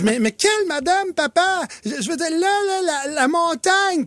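put-on high, innocent child voice; confused kid clearing it up; little girl voice. Daughter explains to her dad which lady she meant. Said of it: "elle dit".

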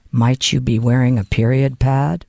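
very low, deadpan monotone; mock-solemn low question; leaning-in agent. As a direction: imitate an officer asking discreetly, deep and level. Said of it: "and says".

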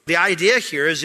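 ordinary delivery

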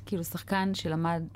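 ordinary talk

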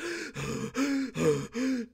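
loud, heavy exaggerated breaths; imitated noisy panting; extreme puffing and panting. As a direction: huff and puff like a winded sprinter. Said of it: "the extreme puffing and panting".

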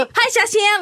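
lightly voiced high teen gal. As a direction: chirp it like a hyper teenager. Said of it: "みたいな。". (テンション高いギャルの真似)

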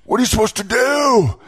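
high-pitched mock outrage of an online fan; Twitter voice. Radio host mocking fans' social media replies in a high Twitter voice. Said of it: "that's my Twitter voice".

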